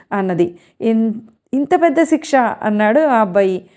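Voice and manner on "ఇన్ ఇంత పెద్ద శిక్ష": mock shock. Boy reacts to her vow.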